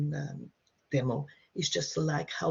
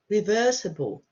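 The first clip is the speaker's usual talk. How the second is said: usual speaking voice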